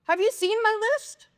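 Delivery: high, indignant whine; exasperated Martha. Retold or imitated. Martha bosses Jesus about her list, high and exasperated. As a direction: pitch it way up, fed up. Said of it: "be a little bit like Martha and be like".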